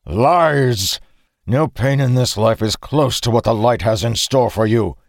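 furious, forceful threat; snarled. A narrator's reading. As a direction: snarl it at an enemy. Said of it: "he snarled".

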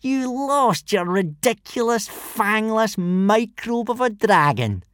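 high, slow, scornful taunt; jeering. A narrator's reading. Bully mocking Hiccup's lost dragon, jeering. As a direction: stretch every insult out derisively. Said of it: "jeered Snotlout".

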